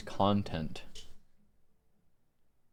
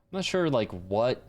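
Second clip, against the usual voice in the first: usual speaking voice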